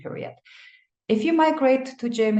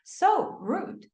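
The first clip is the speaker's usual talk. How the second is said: mock offended; 8 semitones higher, slower than usual. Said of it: "I would say".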